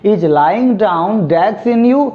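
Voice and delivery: very slow, every word laid out; slow model sentence. Shown as a demonstration. Teacher to students reading the example sentence very slowly. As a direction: read it out slowly for the class to copy. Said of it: "जब भी sentence आएगा".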